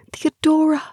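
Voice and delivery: plain reading voice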